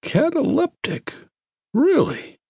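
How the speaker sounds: lightly voiced astonished doctor; surprised Harris. A narrator's reading.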